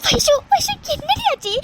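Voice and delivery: frightened friend begging for answers; anxious rising plea; alarmed. Acted. Friend panics at her crying.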